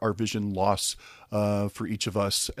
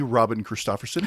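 ordinary talk, nothing marked

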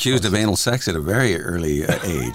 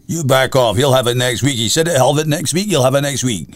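louder, higher imitated Scottish confrontation; intimidating Scottish father; 5 semitones higher, 4 dB louder than usual. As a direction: stand toe to toe with the landlord. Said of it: "He did talk like that".

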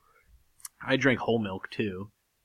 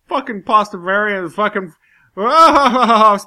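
imitating a friend; 8 semitones higher, 9 dB louder, slower than usual. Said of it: "he's like".

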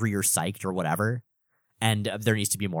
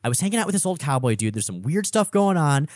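higher, rushed bewildered sidekick; bewildered cowboy; 8 semitones higher than usual. Retold or imitated. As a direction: babble like a confused outlaw out of his depth. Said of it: "Sundance is like".

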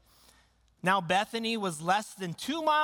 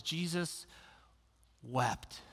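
hushed reverence; 2 semitones lower, 8 dB quieter, slower than usual. Acted. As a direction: let the verse sink in quietly. Hushed reading of shortest verse.

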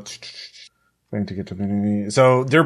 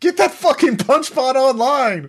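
high-pitched in-character scream, drawn out; screamed order; slower than usual. Pretend. Player screaming an order to get the punch bot online, screaming. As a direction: scream the command at your crew, high and slow. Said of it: "I'm screaming at".

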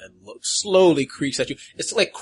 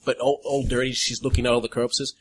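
ordinary talk

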